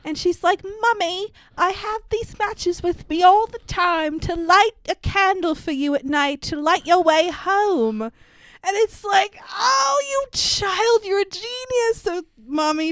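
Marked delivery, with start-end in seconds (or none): 0.6-8.0 mock British little-girl voice; British child. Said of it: "I don't know why she's British". 9.5-12.0 very high, drawn-out adoring cry; hammed-up rapture; mock gushing. Acts overjoyed reaction to child's matches.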